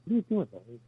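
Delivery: understated, softly serious question; serious director. Retold or imitated. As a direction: imitate a director who never jokes. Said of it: "he was always very serious".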